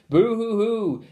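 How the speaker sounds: hammed-up boo-hoo wail; mock family weeping. A narrator's reading. Family weeps together, hammed-up boo-hoo. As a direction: weep it comically.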